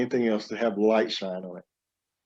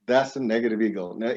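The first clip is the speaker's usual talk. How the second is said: normal talking voice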